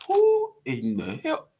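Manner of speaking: high incredulous who in the hell; sassy mock confrontation; indignant. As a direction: demand it in mock outrage. Confronting a cussing kid.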